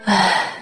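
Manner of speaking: heavy audible sigh to herself; weary sigh. Acted. Mother waiting alone for her son, troubled sigh. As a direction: let out a troubled, low sigh. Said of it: "李刚妈自言自语道".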